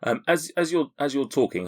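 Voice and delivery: usual speaking voice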